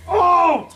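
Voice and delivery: high, loud "oh"; sharp jump up into a held cry; sudden yelp. Real, not acted. Reacting during the toe mishap.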